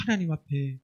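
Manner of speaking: usual speaking voice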